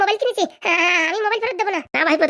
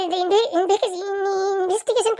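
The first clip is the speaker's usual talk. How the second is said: stammering; 2 semitones higher, slower than usual. Acted. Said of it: "কথা বলতে তুতলাচ্ছিস কেন?".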